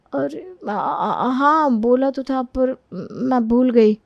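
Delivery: slow, halting, stammered admission; stall, then admit you forgot; sheepish hesitation. Acted. Caught out, admits forgetting. (झिझकते, अटकते हुए)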